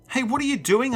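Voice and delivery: high-pitched, alarmed yell. A narrator's reading. Boy to monsters at his dresser, yelling. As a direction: call out high in protest. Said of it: "I yelled".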